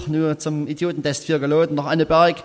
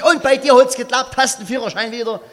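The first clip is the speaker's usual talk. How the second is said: keyed-up friend asking, pitched up; eager; 7 semitones higher than usual. Acted. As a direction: blurt it out impatiently. Friend eagerly asks result.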